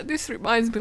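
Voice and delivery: ordinary delivery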